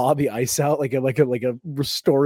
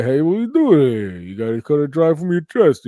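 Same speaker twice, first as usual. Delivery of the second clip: louder, slurred boxer demo; Rocky; 4 semitones higher, 5 dB louder than usual. Shown as a demonstration. Showing friends how to do the boxer, slurred impression. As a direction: drive the slurred voice from the chest. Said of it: "you keep your face where".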